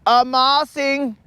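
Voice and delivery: put-on high, gushing wedding planner accent; hammed-up accented planner, drawing out the praise; Franz. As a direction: gush in a put-on high foreign voice. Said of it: "Creel's out there and he's like".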